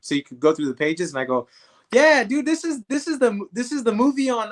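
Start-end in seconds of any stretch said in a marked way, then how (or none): 1.9-4.5 much higher, eager answer; bursting to show he knows. Retold or imitated. Eagerly telling Sly what the booklet is, much higher.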